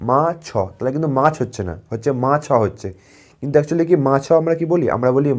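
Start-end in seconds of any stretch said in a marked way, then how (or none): 0.1-0.5 মা ছ said as two syllables, ছ with its own vowel; split into two beats. Shown as a demonstration. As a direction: break মাছ apart into মা and ছ. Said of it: "এইভাবে বলার চেষ্টা করো".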